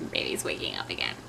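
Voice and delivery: hushed whisper, a little quick; quick quiet whisper. Real, not acted. Baby stirring nearby.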